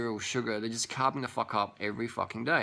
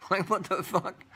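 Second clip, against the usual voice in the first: high-pitched, incredulous laugh through the words; baffled, amused outburst; laughing disbelief; 8 semitones higher, slower than usual. Acted. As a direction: laugh at how absurd it is, pitch jumping up. Mock disbelief at absurd idea.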